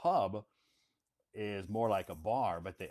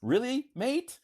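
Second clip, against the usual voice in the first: British mate voice; 7 dB louder than usual. Retold or imitated. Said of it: "I didn't hear what you said".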